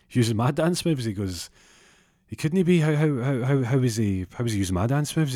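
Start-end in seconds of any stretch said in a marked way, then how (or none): 0.1-1.0 raised, disbelieving question; acted-out shock at being copied; can't believe what he's hearing; incredulous. Hears rival used his moves. 2.2-5.3 high, stammering protest; acted-out stuttering shock; flustered disbelief. Acted disbelief at being copied.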